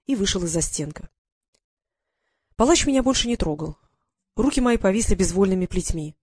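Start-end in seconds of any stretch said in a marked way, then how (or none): none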